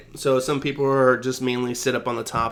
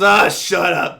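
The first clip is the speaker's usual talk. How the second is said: loud, higher dismissive mock shout; cranky old man; 5 semitones higher, 7 dB louder, slower than usual. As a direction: brush him off like a crabby old guy.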